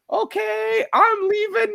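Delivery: imitating a friend in a high falsetto; high mock announcement; high-pitched mimicry. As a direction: put on a high voice for the quote. Mimicking Dan announcing he's leaving.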